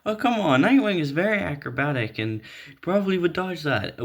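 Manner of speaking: mock an indignant fan; imitating Nightwing fans objecting; mock whiny fan. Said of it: "some Nightwing fans will be like".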